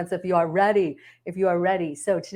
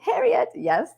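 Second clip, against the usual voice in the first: ordinary talk, nothing marked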